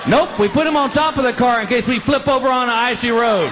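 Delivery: loud, high mock-helpful sarcasm; sarcastic comeback. Comic answers the skier question, loud sarcasm. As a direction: shout the answer like it's obvious.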